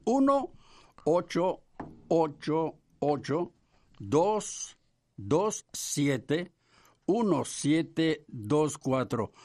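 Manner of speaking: measured, paused number read-out; dictating slowly. Real, not acted. Co-host repeating the phone number to clear up doubts, slow dictation. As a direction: space out the digits for note-takers.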